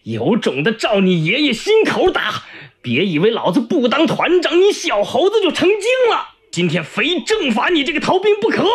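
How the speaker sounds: high, furious taunts, climbing as they go; defiant cursing. Acted. Prisoner daring his armed captor, defiant and furious. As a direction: dare them to shoot, refusing to back down. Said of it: "仍不示弱".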